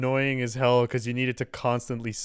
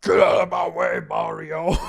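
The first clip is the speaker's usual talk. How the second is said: mock racer shout; 6 semitones higher, 7 dB louder than usual. Racer shoving rivals off the track, yelling. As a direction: shout it like a pushy kart racer.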